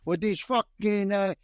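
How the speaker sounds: hammed-up, drawn-out New York accent. Mock documentary on goombas over here, hammed-up New York accent. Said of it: "documentary in that accent".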